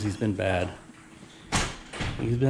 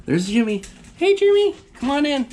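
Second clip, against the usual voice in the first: high call to dog; 12 semitones higher than usual. Real, not acted. Owner calling her dog inside, very high.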